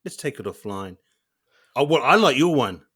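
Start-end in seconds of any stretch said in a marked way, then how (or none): 0.0-1.0 passive aggressive. Retold or imitated. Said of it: "that sounds too passive aggressive".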